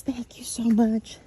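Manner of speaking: imitated whispered thanks; hushed, heartfelt whisper of gratitude; gentle, loving. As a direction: whisper it tenderly, as the grateful mom. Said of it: "the mom just gently and lovingly looks towards me".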